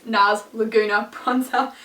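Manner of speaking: slow, measured product name; said slowly. Shown as a demonstration. For viewers who don't know the product, slowly. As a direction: spell it out word by word, unhurried. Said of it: "I should say that slowly for people".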